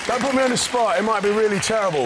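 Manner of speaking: high, loud, flustered protest; laughing it off under pressure. Real, not acted. Put on the spot to rap.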